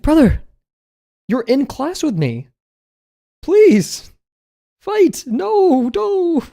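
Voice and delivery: very high, loud, hammed-up begging; mock exasperated plea. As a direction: plead at the top of the voice range, playing exasperated. Acted exasperated plea to clueless classmate.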